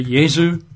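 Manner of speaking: Jesu said YAY-zoo; German pronunciation. Shown as a demonstration.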